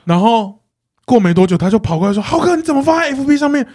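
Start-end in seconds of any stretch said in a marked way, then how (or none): 2.2-3.7 mock angry coworker. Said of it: "然后她就很生气".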